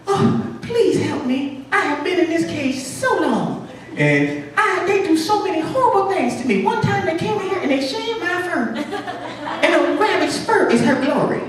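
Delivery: very high falsetto lament; teeny female rabbit. Acted. Caged rabbit begging the mouse for help, high plaintive falsetto. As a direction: plead in a tiny, high, melodramatic voice. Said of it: "little teeny rabbit inside of a cage".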